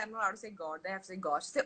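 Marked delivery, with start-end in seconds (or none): none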